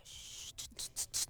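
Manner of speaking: soft, breathless shh-ch-ch hissing; retelling a companion hushing her; imitated shushing. Travel companion hushing her Skype call, imitated soft shushing. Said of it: "y me decía".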